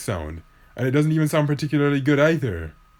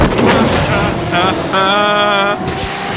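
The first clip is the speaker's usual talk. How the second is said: teasing laugh; 6 semitones higher than usual. Real, not acted. Mock laugh at spit joke.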